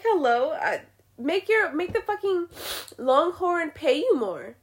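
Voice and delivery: normal talking voice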